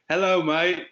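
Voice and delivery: a light British carpenter's "Hello, mate"; British accent. Retold or imitated. Telling his cohost how the carpenter greets him, light British accent. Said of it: "I love his accent".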